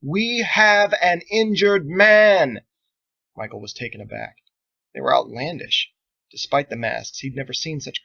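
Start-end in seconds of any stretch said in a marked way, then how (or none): 0.0-2.7 loud, high, very slow shouted words; long loud syllables. Acted. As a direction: stretch every syllable so a foreigner understands. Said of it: "shouted in long, loud syllables".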